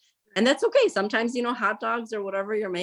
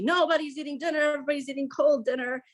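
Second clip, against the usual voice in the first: mock yell; 8 semitones higher, faster than usual. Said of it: "it went from screaming at everybody".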